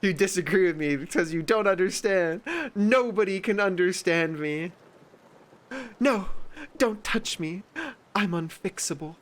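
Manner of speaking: mock self-pity. Mocking attention seekers, high whiny self-pity. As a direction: whine like a drama queen.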